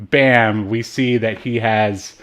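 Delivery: ordinary delivery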